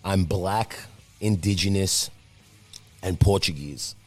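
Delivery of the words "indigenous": ordinary talk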